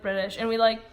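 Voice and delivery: usual speaking voice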